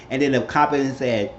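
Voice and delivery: usual speaking voice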